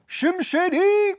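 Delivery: high-pitched hype call on a name; playful high call. Pretend. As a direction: belt out the nickname high and slow. Riffing on the driver's name.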